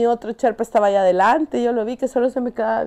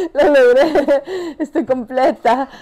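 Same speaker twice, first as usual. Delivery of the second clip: laughing, raised, louder cry of triumph; laughing relief; 4 semitones higher, 5 dB louder, slower than usual. Retold or imitated. Climber reliving reaching base camp alive, laughing in relief. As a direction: celebrate through laughter, drawing out the words. Celebrating her survival, laughing.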